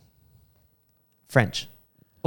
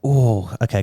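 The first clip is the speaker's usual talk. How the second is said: ordinary delivery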